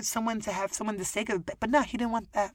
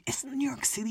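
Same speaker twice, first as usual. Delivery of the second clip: ordinary talk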